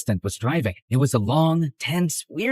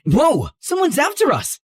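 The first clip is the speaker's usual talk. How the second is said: alarmed; 10 semitones higher than usual. Acted. Rammed from behind in car.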